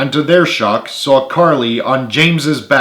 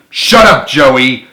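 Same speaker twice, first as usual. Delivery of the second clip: yelled; 2 semitones higher, 7 dB louder, slower than usual. A narrator's reading. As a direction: yell him down. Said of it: "James and Carly yelled together".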